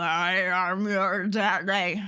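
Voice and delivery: low, slow, deepened "daddy"; deep voice. Pretend. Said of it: "I need, like, a voice warper".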